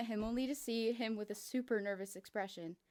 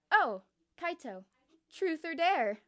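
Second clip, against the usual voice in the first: playful call; 7 semitones higher, 10 dB louder, slower than usual. Acted. Kokichi asks playfully.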